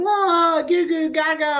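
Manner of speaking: put-on high, gushing baby-talk coo; awestruck tourist. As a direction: mimic gawking tourists, high. Mimicking awed foreign tourists.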